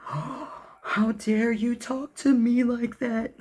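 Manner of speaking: very high, very slow offended drama-queen voice; mock outraged victim. Selfish ex confronted about behavior, hammed-up offended whine. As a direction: ham up the wounded indignation. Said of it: "it's like".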